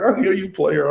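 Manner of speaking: high-pitched, amused mock admiration; teasing quoted reply. Replying to friend's in-law plan, playful high teasing. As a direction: hype him up in a high, playful voice. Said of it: "I was like".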